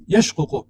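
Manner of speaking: یشقق as yash-qu-qu, both qafs separate; unmerged original form. Shown as a demonstration. Teacher gives the original of the verb, یشقق pronounced yash-ququ. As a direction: say یشقق with a still sh, then two short qu syllables. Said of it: "اصلی از این بود".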